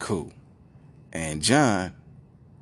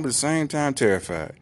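ordinary delivery

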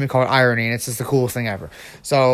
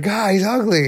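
higher, hammed-up disgust; mock crazed villain; 7 semitones higher than usual. Joking about a crush's boyfriend, mock crazed. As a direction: whine it in a put-on higher voice.

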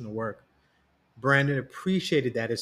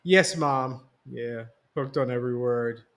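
sarcastic. A narrator's reading. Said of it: "he answered sarcastically".

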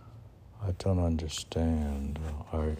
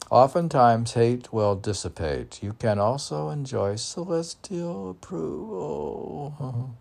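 loud, rising recital, breaking toward a cry; poem recitation; 7 semitones higher, 6 dB louder than usual. Acted. Host reads out uplifting poem lines, loud and high. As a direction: proclaim each line like a blessing. Reading another poem excerpt.

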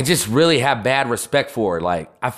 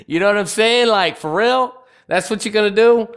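pitched-up, mocking disbelief; jeering at a YouTube DIY dreamer; incredulous mockery; 7 semitones higher than usual. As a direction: scoff at the idea in a high voice.

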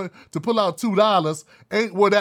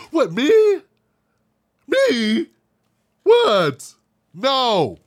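mock surprise; 4 semitones higher, 5 dB louder, much slower than usual. Mocking the organization's denial.

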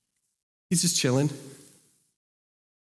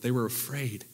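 softer, sinking the words in; hushed repeat; 4 semitones lower, 9 dB quieter than usual. Shown as a demonstration. Pastor lets the line sink in, softer and hushed. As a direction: let "They were afraid" settle, much quieter. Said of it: "let that sink in".

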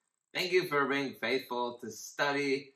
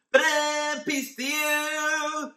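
much higher, louder, slow sung-out ta-da entrance; over-the-top fanfare greeting; 9 dB louder, slower than usual. Pretend. Teacher joking how Jesus might have greeted them, hammed-up fanfare. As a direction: burst in with a comic fanfare. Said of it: "maybe he said it like that".

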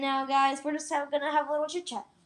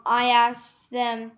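ordinary talk, nothing marked

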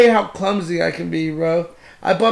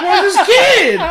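higher, laughing mock panic; 9 semitones higher than usual. Retelling his reaction to the lost son, high and laughing. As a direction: play a panicked parent half-joking. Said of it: "I was like".

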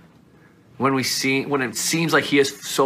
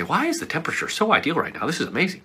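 higher and fast, playfully marvelling at the perfect temperature; mock amazement; 7 semitones higher, much faster than usual. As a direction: ham up delight about the room. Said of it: "you don't say".

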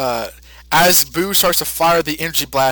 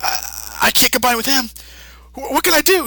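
Goku; 8 semitones higher than usual. Retold or imitated. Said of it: "and says".

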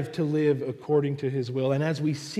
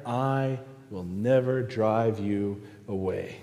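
low, slow, deliberate promise; Jesus; 4 semitones lower than usual. Acted. Jesus reassuring the anxious believer, slow low promise. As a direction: reassure slowly, pitch lowered. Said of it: "And we hear Jesus say".

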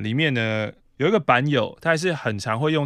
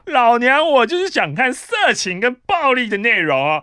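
shouting out; 9 semitones higher than usual. Acted. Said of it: "在这边我要喊话".

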